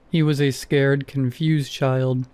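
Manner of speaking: ordinary delivery, nothing marked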